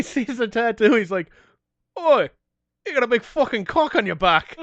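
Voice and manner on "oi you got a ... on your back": loud, high mock Aussie-Brit rasp. Retelling the singer meeting the tattooed guy, mock accent. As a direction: ham up the rock singer's accent. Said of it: "[name] of A C D C".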